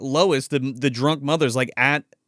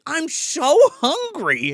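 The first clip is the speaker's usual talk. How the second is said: high, drawn-out mock moan; hungry wife; 13 semitones higher, much slower than usual. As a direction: imitate a famished wife, stretching the words out. Wife's odd hungry complaint.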